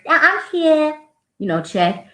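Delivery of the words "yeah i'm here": put-on high childlike reply; kid answering. Student answering roll, high childlike voice. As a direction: pipe up like a first grader.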